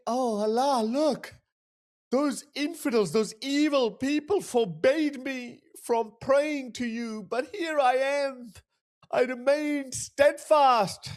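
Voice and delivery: high, slow, pompous mock prayer voice; mock pious worshipper. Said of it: "In his mind, he's feeling".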